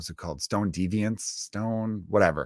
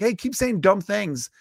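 put-on scolding a rude kid, higher and slowed; mock scolding; 8 semitones higher, 3 dB louder than usual. People telling a mean kid off, mock scolding. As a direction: lecture him, a touch higher. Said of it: "You should be like".

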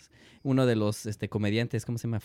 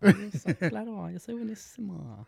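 sarcastic; 10 semitones higher than usual. Pretend. Sarcastic self-praise.

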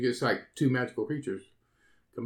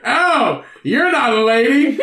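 high, loud, laughing letdown; a hammed-up shock of disgust; mock dismay at the clown; disappointed leprechaun; 8 semitones higher, 6 dB louder, slower than usual. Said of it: "And he goes".

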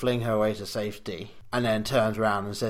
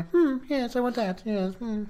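put-on much higher, spoilt childish queen; Red Queen; 13 semitones higher than usual. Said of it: "very spoilt, childish Red Queen".

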